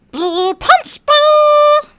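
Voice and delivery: high, slow, squeaky alien cry; Stitch voice. Retold or imitated. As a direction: stretch it out like Stitch. Quoting Stitch voice.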